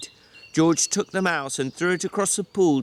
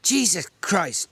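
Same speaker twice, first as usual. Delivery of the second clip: resigned; 7 semitones higher, slower than usual. Acted. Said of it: "George said resignedly".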